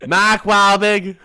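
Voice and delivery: loud, high, hammed-up Boston accent. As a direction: call out names in a put-on Boston accent. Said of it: "getting my Boston accent in".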